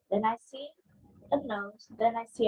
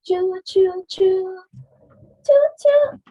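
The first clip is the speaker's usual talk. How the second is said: loud high choo choo train sounds; train whistle choo choo; 8 semitones higher, 9 dB louder, much slower than usual. Acted. Child blowing the toy train whistle, loud high choo choo.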